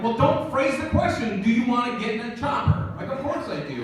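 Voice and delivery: high-pitched, incredulous comeback; mock exasperation. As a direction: protest as if it's obvious. Arguing back at the firefighter.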